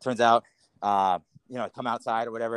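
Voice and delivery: ordinary talk, nothing marked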